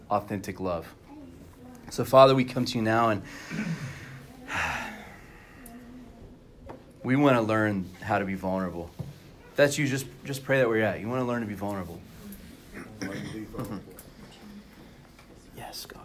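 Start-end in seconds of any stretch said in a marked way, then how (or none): 2.0-13.1 soft, hushed prayer voice; closing prayer. Acted. Pastor praying over the congregation, soft and hushed. 15.6-16.0 whispered yes. Real, not acted. Whispered aside during prayer.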